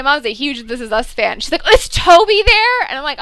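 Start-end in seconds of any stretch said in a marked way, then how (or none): 1.7-2.8 2 higher, louder excited ask, drawn out slowly; 3 a fan who can't wait to hear; 1 eager question. Retold or imitated. 4 mom asks her daughter about her favorite actor, excited.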